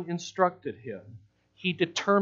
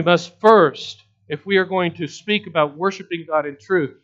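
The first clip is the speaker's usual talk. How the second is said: raised, forceful pulpit voice; emphatic preaching; 5 semitones higher, 9 dB louder than usual. Real, not acted. Preacher urging congregation to heed God's holiness, emphatic. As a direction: preach it loud, driving each point home.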